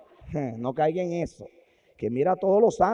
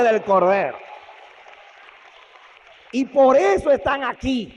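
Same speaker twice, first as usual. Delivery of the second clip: high, loud, slow proclamation; solemn angel; 9 semitones higher, 10 dB louder, slower than usual. Acted. Angel solemnly announcing who dwells in heaven.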